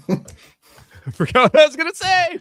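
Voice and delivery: high, loud, drawn-out laughing squeal; laughing outburst. Real, not acted. Podcast guest admitting a lost thought to co-hosts, laughing outburst. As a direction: crack up and wail it through the laugh. Loses his thought and cracks up.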